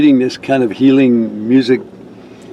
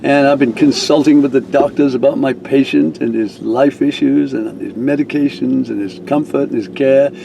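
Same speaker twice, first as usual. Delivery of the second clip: ordinary talk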